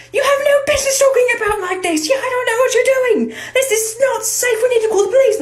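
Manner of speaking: very high-pitched hysterical teacher impression; freaking-out teacher. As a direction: squawk in mock panic, all in falsetto. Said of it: "has her big freak out".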